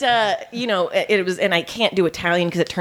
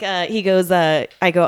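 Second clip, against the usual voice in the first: normal talking voice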